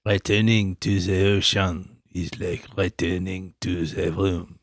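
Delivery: slow, low, smoky French voice; like after a long drag; smoky drawn-out quote. Pretend. Co-host quoting Cousteau like a smoker, slow and low. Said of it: "long drag of a cigarette".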